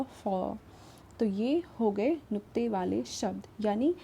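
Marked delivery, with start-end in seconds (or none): 0.0-0.6 फ said as fa with lip and teeth; फ as English f, not pha; nukta fa sound. Shown as a demonstration. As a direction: bite the lip lightly for फ. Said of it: "फा के नीचे बन जाएगा".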